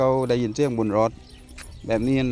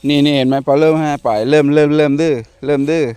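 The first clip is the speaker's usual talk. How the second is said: loud, higher exasperated scolding; scolding the dog; 4 semitones higher, 9 dB louder than usual. Real, not acted. Owner scolding his disobedient dog, loud exasperated scold. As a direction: let the frustration out loud at the dog.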